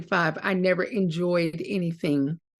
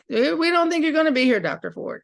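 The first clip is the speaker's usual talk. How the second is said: higher and louder, quick mock of her voice; imitating a refugee woman; 7 semitones higher, 3 dB louder than usual. Refugee woman warning the doctor, in an imitated voice. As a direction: put on the woman's voice, starting with a lifted "Eh". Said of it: "one of the women said".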